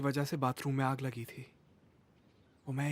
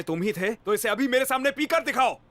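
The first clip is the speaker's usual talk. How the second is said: raised voice climbing high into a furious demand; angry challenge; 9 semitones higher, 4 dB louder than usual. Acted. Teacher daring a student to smoke before him, angry challenge. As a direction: challenge him loudly, pitch jumping up. Teacher confronts boy over smoking.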